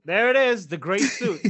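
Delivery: high, loud call on picking him out; mock spotting call. Relatives spotting him by his suit at family events, mock excited call.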